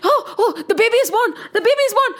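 high, flustered announcement; acted excited outcry; alarmed guard. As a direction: raise the alarm in a panicky high voice. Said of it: "The, the guard said".

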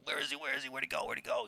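high, hushed, frantic questions; quick small voice hunting around for someone; imitating an excited superfan; mock eager searching. Manager searching for the star.

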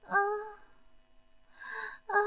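drawn-out moan of release; breathy climactic sigh; long moan. A narrator's reading. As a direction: let out two long acted moans. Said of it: "长长的哼了一声".

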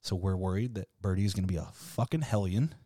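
ordinary delivery

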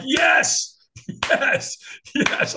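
high, loud retold cheers of yes; eager crowd cheer. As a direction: imitate a crowd egging you on.

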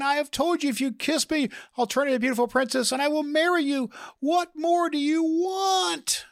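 shrieky high, exasperated female frog; mock scream, pitched very high and relentless. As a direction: rant in a high put-on voice, drawing out the final demand. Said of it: "yells out in just a screaming voice almost".

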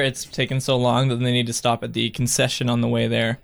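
normal talking voice

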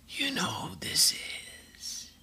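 hoarse whispered rasp, high. Retold or imitated. Caller rasping a whisper to wake him at night. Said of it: "I hear somebody go".

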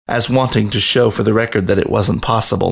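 ordinary delivery

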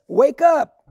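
fervent rallying cry from the pulpit; preacher's call. Acted. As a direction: ring it out to rouse the sleepers. Preacher calling out to the congregation.

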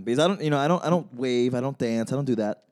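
ordinary talk, nothing marked

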